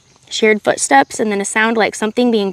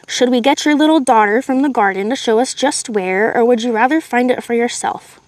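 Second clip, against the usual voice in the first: ordinary delivery, nothing marked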